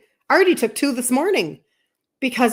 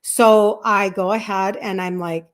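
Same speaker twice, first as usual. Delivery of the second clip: ordinary talk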